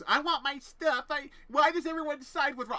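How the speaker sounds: mock her whining; mocking whiny voice. Imitating the roommate whining for her stuff, high and mocking. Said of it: "she was all like".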